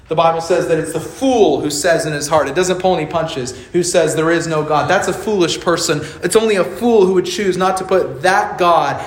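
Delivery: rising, insistent sermon voice; preacher building to a climax; impassioned preaching. Acted. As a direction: drive the point home to the congregation.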